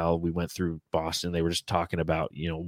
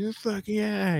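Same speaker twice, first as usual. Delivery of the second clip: imitating a fan cheer; much slower than usual. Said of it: "everyone's like".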